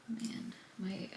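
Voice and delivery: a worn-out breathless whisper; whispered under her breath, drained; tired whisper. Real, not acted. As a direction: sigh the words out, exhausted. Worn out from endless clicking.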